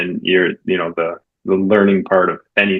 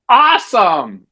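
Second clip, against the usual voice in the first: loud, high-pitched burst of delight; over-the-top enthusiasm in a retold reply; gleeful cheer; 11 semitones higher, 5 dB louder, much slower than usual. Acted upbeat reply to bad news.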